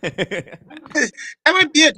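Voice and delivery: slow, laughing through it; giggling out the words. Real, not acted. Laughing at show name joke.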